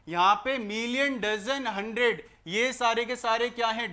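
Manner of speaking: normal talking voice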